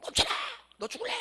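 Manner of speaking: 음량은 누르고 아주 높게 뒤집은 가짜 고함; 과장된 위협을 흉내 내듯; 외치는 시늉. Acted. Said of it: "이래야 되겠지". (목 조인 호통 흉내)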